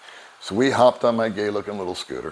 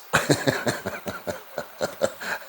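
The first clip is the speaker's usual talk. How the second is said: quiet breathy laugh; 5 dB quieter than usual. Real, not acted. Laughing at own story.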